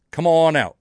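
half Australian, half Southern accent by accident; accidental Kentucky accent. Real, not acted. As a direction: slip into a botched Kentucky accent on "come on out". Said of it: "sounded like I was trying to do a Kentucky accent".

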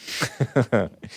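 brief rapid chuckle; real chuckle. Real, not acted. Host amused by the greeting, real chuckle. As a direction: chuckle after the silly word. Laughs at the Spanish word.

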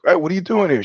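high-pitched, mildly surprised hello; surprised greeting. Retold or imitated. Greeting a jujitsu partner unexpectedly, mild surprise. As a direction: re-enact a pleasantly puzzled greeting.

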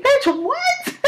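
high, sharp mock disbelief; incredulous. Mother retorting to her daughter, high and incredulous. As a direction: act stunned at the toddler's excuse. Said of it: "And it's like".

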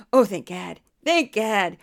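loud, overdone relief; panicky mom relieved. Acted. Mom hearing daughter is fine, hammed-up relief. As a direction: gush it like an overanxious mother. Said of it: "so she's panicked at all time".

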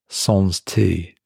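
ordinary delivery, nothing marked

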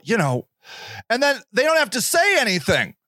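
normal talking voice

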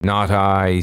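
ordinary delivery, nothing marked

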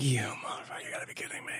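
under-the-breath whispered curse; muttered curse. Retold or imitated. Arrested man cursing the police under his breath, whispered. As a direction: whisper the swear through disbelief. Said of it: "And I'm like".

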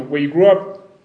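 ordinary talk

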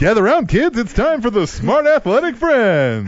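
put-on loud high kids show host. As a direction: call the kids over like a cheery TV host. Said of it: "kids show host".

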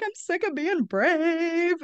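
mock tearful, drawn-out lament laced with laughs; a hint of sobbing, laughed off; retold breakdown. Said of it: "I was sobbing".

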